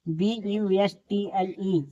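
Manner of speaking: ordinary talk, nothing marked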